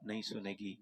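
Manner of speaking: ordinary talk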